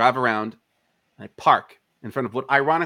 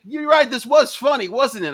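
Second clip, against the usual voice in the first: very high, loud mock-jolly voice; hammed-up sudden friendliness, high and loud; mock a cop trying to share the joke; imitated chummy cop; 12 semitones higher, 7 dB louder than usual. Cop sheepishly agrees, imitated.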